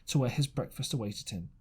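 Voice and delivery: ordinary delivery, nothing marked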